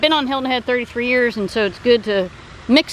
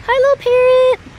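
squeaky high, louder sing-song greeting; pet talk; 13 semitones higher, 5 dB louder than usual. Real, not acted. To the pet parrot, squeaky high pet-talk voice.

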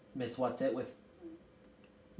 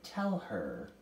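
low, weary request; a touch of exhaustion, pitched low; very tired; 7 semitones lower than usual. A narrator's reading. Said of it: "voice seemed very tired".

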